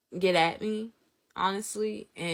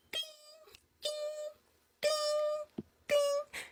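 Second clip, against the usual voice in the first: high, soft, spaced-out dings; imitated register ding; 9 dB quieter, much slower than usual. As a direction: chime like a till in a tiny high voice. Imitates the register while ringing up.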